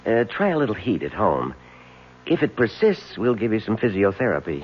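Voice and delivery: doctor's voice. Retold or imitated. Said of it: "I went to the doctor".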